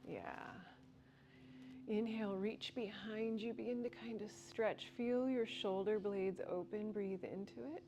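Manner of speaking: soft, unhurried breathwork guidance; guided breathwork. Acted. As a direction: guide the class gently into the stretch. Leads a breathwork stretch.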